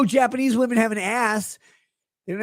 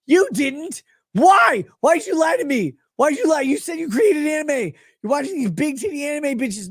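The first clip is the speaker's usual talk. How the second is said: mock outrage; 9 semitones higher than usual. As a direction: yell at him like he betrayed you. Ranting at Jim.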